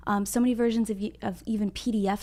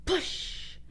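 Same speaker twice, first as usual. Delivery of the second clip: whisper; 4 semitones higher, 13 dB quieter, much slower than usual. Real, not acted. Whispered cue to the children.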